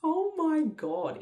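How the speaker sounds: high, slow gasp of wonder; first sight of the baby; awed. Retold or imitated. Baby handed to her.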